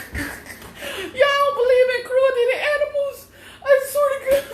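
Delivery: high laugh-crying wail; near tears. Real, not acted. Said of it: "I'm about to cry".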